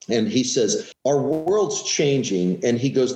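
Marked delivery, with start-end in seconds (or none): 1.0-2.6 slow, weighty delivery; slow pronouncement. Retold or imitated. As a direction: lay it out slowly like a prophecy. Boss's grave pronouncement.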